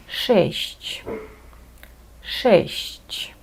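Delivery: шесть said twice as a model, hard SH first, soft SH-CH last. Shown as a demonstration. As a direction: carefully pronounce Polish шесть, hard then soft hiss. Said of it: "Відчуйте різницю і намагайтеся правильно вимовити". (шесть: тверде Ш на початку, м'яке ЩЬ-ЧЬ в кінці)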